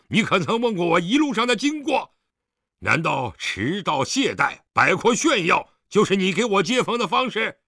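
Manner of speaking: loud, indignant questioning; angry reproach. Acted. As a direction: berate your son with rising force. Father berates his son.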